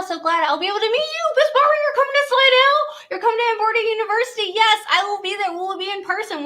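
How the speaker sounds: high, loud, fast gushing; excited. Real, not acted. Thrilled a viewer is coming to Slidell, high and fast. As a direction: gush with delight.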